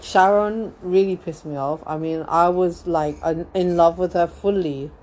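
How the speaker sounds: usual speaking voice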